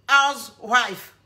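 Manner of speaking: "Housewife" drawn out slowly, each sound stretched as an English try; "Housewife" spelled out slowly in a laboured English accent; careful English word. Shown as a demonstration. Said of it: "L'anglais de ça".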